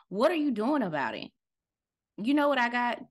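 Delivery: ordinary delivery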